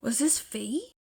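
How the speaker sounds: usual speaking voice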